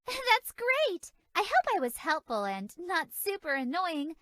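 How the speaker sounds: ordinary delivery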